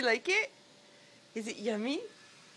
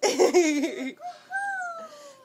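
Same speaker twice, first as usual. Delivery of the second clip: high delighted laugh; 6 dB louder than usual. Real, not acted. Child says moo for rooster.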